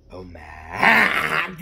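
loud, high mock gasp; mock exclamation. Kid playfully exclaiming Oh my, loud and high. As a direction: exclaim it loudly in fun.

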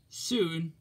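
ordinary delivery

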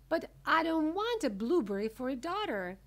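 high, loud protest; yell. A narrator's reading. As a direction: yell it as an outraged mother. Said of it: "yelled Missus Beauregard".

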